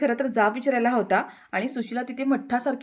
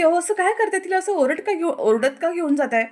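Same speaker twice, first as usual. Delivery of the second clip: higher and louder, alarmed plea; shocked protest; 6 semitones higher, 5 dB louder than usual. Retold or imitated. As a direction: stop her, shocked. Teacher protests child being dragged. (धक्का बसलेला, चढ्या आवाजात विरोध)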